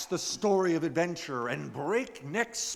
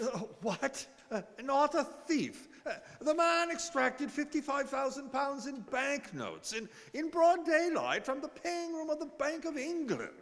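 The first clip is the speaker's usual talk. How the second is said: incredulous; 8 semitones higher than usual. Acted. Club member objecting that the robber is a thief, higher with disbelief. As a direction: pitch climbing in disbelief. Stewart incredulous at card table.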